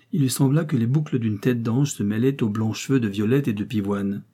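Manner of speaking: plain reading voice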